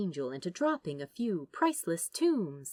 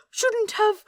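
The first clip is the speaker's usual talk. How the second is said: much higher, louder, shocked repeat; scandalised squeak; 10 dB louder than usual. Acted. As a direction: shriek the words back in disbelief. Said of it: "The Angel's face coloured dramatically".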